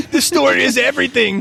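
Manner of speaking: high, loud mock rave; playful high over-the-top praise; mock hype. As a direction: oversell it as a joke. Joking hype.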